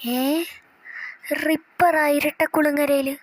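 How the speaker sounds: ordinary talk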